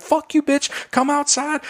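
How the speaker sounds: high-pitched mock challenge to fight; squawked imitation of an angry woman; retold jaw-jacking. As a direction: imitate her calling someone out. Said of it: "they start jaw-jacking back and forth".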